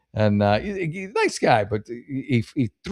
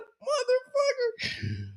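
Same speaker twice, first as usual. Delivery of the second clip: mock anguish; 6 dB quieter, much slower than usual. Reaction to ruined intro.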